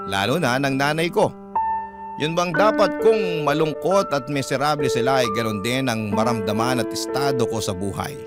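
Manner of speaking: ordinary talk, nothing marked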